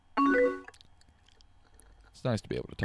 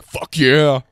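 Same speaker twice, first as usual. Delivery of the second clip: loud gleeful whoop; triumphant shout at the news; cheering shout; 5 semitones higher, 13 dB louder than usual. Real, not acted. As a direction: yell it with delight. Cheering that she broke up.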